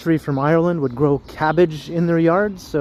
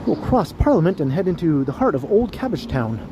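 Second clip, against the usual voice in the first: tour guide voice; 2 semitones lower, 4 dB quieter than usual. Acted. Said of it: "Put on my tour guide voice".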